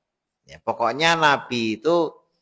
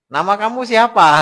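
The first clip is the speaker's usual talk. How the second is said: high, loud demanding question; wife's sharp question; 10 semitones higher, 7 dB louder than usual. Retold or imitated. Wife challenges the robber at the door, high and loud. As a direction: demand the name.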